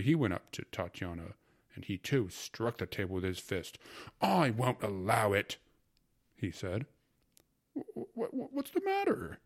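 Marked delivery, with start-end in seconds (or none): 4.2-5.6 angry. A narrator's reading. Angry tutor echoing Fyodor. 7.7-9.4 much higher, stammered and startled; stammering surprise. A narrator's reading. Startled uncle confronted by two angry men, high stammer. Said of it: "asked my uncle in surprise".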